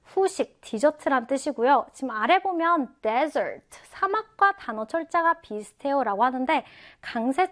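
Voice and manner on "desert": desert with the stress up front on "DES"; teacher's model "desert", strong "des", weak "ert"; stress on first syllable. Shown as a demonstration. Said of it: "앞 음절에 있습니다".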